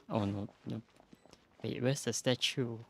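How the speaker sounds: ordinary talk, nothing marked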